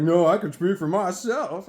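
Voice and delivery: loud, slow put-on tough-guy voice; mock tough buddy. Mocking the buddy's answer to friends, mock tough voice. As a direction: imitate his forceful, slow reply. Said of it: "like that".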